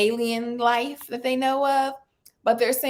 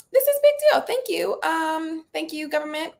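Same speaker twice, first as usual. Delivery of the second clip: excited outburst; 6 semitones higher than usual. Real, not acted. Celebrating the UFO admission.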